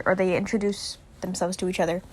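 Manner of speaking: ordinary talk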